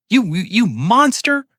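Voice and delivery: high, cracking scream of monster. A narrator's reading. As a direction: scream it as the voice breaks. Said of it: "She screamed, her voice finally cracking".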